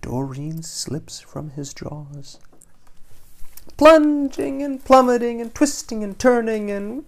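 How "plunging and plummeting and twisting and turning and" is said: excited. A narrator's reading. Fish falling through air.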